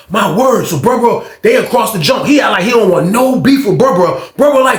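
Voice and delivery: usual speaking voice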